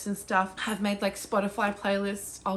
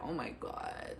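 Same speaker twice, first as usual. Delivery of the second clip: awed whisper; 6 semitones lower, 13 dB quieter than usual. Retold or imitated. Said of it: "I was like".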